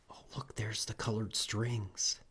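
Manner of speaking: put-on hushed whisper to the kids. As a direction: point it out in a secret whisper. Hushed aside to children.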